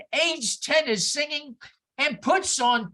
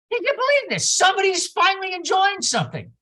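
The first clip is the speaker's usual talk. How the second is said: high, louder delighted disbelief; amazed; 7 semitones higher, 5 dB louder, faster than usual. Retold or imitated. Preacher recalling watching a happy toddler, amazed. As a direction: marvel out loud at the joy. His amazed inner thought.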